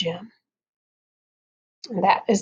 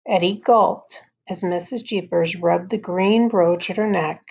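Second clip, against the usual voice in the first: ordinary delivery, nothing marked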